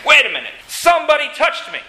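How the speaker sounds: acted sudden stop, high-pitched; sudden halt. Jesus stops the crowd after being touched, sudden halt.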